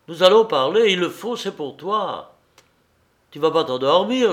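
emphatic, anxious urging; urgent insistence. A narrator's reading. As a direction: insist that a friend listen. Friend urgently presses him to talk.

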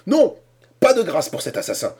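high, loud vengeful cry; shouting. A narrator's reading. Cornelius cries out against the assassin. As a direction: yell it out for revenge. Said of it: "cria Cornelius".

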